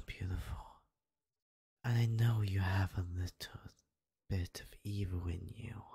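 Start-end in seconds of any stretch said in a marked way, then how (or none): 1.8-5.8 demonic whisper. Acted. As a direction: breathe it like a temptation. Tempting her dark side.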